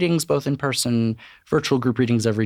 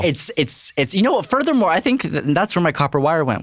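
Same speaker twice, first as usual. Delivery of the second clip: mock outrage; 7 semitones higher, 3 dB louder than usual. Joking ex is meth gator.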